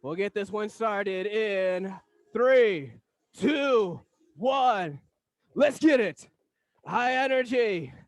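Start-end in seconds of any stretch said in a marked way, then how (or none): 2.3-6.3 loud, pumped call to start; hype shout. Real, not acted. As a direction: fire the class up. Countdown hype to class.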